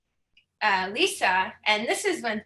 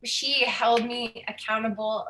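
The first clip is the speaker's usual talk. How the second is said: ordinary talk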